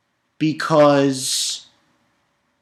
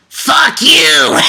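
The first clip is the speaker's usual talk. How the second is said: high furious yell, much louder; mock furious shout; defiant shout; 13 semitones higher, 8 dB louder than usual. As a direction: scream it in his face.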